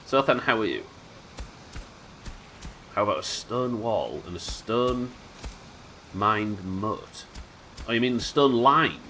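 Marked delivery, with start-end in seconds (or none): none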